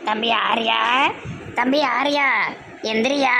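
put-on a cunning old mother coaxing her son; scheming mother.

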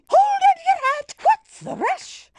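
fast, high excited call; rally everyone as the car speeds up; excited cry. Acted. Old lady cheering the race.

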